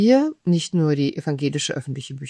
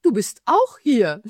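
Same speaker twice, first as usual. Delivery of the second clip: retold cheerful greeting, higher and a bit louder; glad surprised call; 6 semitones higher, 4 dB louder than usual. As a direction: call out happily to a familiar face. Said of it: "kommt durch die Tür und ruft".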